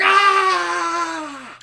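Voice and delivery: long, high, loud mock scream; retold scream. Retelling the waste blown into his face, mock scream. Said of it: "he just screams".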